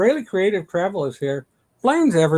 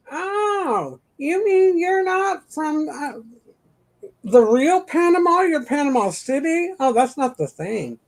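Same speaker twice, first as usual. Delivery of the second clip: mock clueless reply; 8 semitones higher than usual. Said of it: "What if I said".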